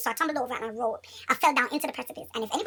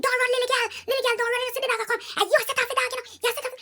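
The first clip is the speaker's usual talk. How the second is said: screaming lady; 12 semitones higher, faster than usual. Retold or imitated. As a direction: shriek it as the dog owner would. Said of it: "the lady starts screaming".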